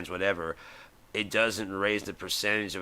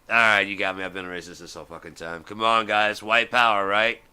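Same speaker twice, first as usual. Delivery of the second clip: louder, cheerful boast; gleeful mock bigot; 7 dB louder than usual. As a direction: proudly drop the act. Duke finally owns it.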